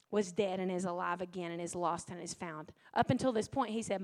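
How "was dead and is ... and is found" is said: soft, lower pleading; father gently pleading with his son; gentle pleading. Acted.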